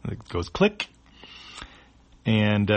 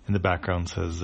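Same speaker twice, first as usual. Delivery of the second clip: usual speaking voice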